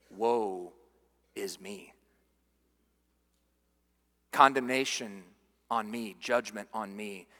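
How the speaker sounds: very low and soft, long pauses; quoting a man undone, barely audible; hushed lament. Retold or imitated. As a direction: let each phrase sink almost to nothing. Voicing Isaiah's response of self-condemnation.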